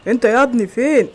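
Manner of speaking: shouted summons; yelling. Acted. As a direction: call out sharply at full volume. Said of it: "كده يزعق معايا".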